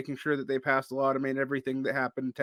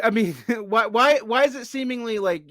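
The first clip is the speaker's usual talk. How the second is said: asking through a laugh, pitched up; laughing question; 7 semitones higher, 4 dB louder than usual. Real, not acted. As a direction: chuckle while asking why. Laughs while asking his question.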